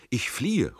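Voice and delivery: high, loud cry in flight; calling out. A narrator's reading. Fleeing Tränk to his comrade, calling out. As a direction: call it out urgently to a comrade. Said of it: "ruft Tränk".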